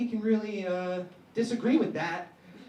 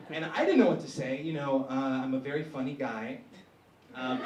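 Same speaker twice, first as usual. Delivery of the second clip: usual speaking voice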